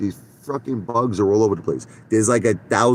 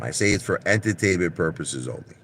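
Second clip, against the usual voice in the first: ordinary delivery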